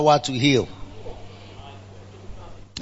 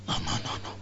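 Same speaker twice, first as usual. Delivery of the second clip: whispered no; 14 semitones lower, 12 dB quieter, faster than usual. Real, not acted. Whispered refusal aside.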